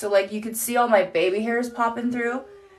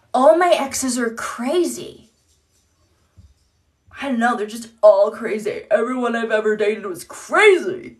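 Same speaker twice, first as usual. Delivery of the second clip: red-flag guy; 5 dB louder than usual. Pretend. Mom mocks a man who says all his exes are crazy, louder. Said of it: "And then there's this".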